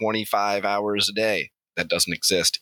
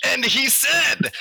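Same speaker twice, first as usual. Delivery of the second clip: mock shout; 4 dB louder, slower than usual. Narrator shouts Steve's silent terror.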